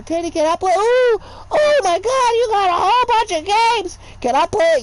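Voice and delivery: put-on high, loud, eager child; excited kid voice. Nieces and nephews begging Teddy to play, excited kid voice. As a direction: squeal like a kid spotting new games. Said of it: "nephews come over".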